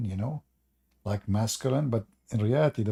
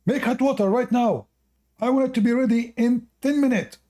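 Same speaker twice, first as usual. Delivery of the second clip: higher, louder hammed-up command; bossy husband order; 12 semitones higher, 3 dB louder than usual. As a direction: bark the order like a boastful husband. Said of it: "He said".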